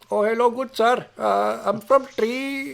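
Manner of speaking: imitating a caller's Indian accent, high-voiced. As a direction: put on an Indian call-centre accent. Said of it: "I'm doing an Indian accent".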